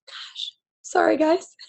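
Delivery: choked-up whispered apology; tearful, barely voiced whisper; ugly cry. Real, not acted. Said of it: "bust out the ugly cry".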